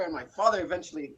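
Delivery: ordinary talk, nothing marked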